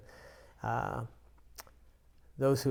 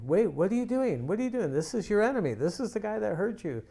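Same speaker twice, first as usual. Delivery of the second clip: higher, alarmed inner voice arguing back; inner voice objecting; 7 semitones higher, faster than usual. Retold or imitated. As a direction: voice your own head protesting in alarm. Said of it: "my head is thinking".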